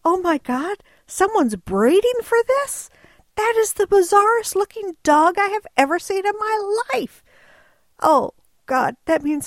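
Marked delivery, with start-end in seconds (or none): 0.1-7.2 amazed exclamation. Retold or imitated. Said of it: "I've gotta say".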